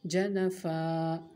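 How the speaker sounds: जनफा said very slowly with a long final aa; pausal form. Shown as a demonstration. Teacher shows how जनफन becomes जनफा at the stop. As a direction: stretch जनफा, ending on a long aa. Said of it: "इसी तरह यहाँ पर है जनफन".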